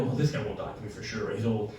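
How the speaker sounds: very low and hushed, to himself; muttered private thought. Retold or imitated. Passenger reassures himself about the old man approaching, muttering low. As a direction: drop it right down, a confidential aside.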